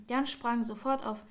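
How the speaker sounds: ordinary delivery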